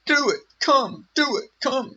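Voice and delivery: high, loud parroted taunt; mocking impression. Vlogger parrots the played clip to ridicule it, high mocking voice. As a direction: mimic a worked-up challenge in a squeaky put-on voice.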